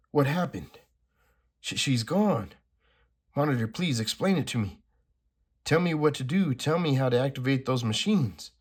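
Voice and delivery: lightly acted comic-book dialogue; dramatic comic reading. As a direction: read the panels like a dramatic script. Reads comic dialogue aloud.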